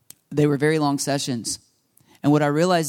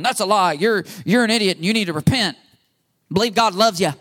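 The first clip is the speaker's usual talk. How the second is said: hammed-up rebuke, pitched up; harsh scolding; 4 semitones higher than usual. Shown as a demonstration. Pastor showing how not to help the wounded, in a mock scolding. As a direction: berate them as a mock heavy-handed preacher. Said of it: "I'm not going".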